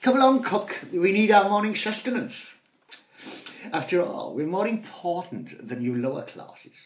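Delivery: high, loud haughty lady scolding the cook; shrill-free but high and loud mock-aristocratic scold; scolding posh mother. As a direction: look down your nose at the servants. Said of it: "scolding the cook and scullery maids".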